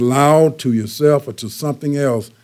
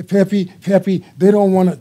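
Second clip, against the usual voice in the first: higher voice talking loudly to a dog; 7 semitones higher than usual. Retold or imitated. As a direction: talk to the dog so the people hear. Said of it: "she's talking loud".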